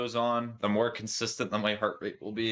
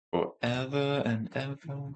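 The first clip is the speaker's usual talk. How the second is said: drawn out; 5 semitones higher, slower than usual. Pretend. Mock lament.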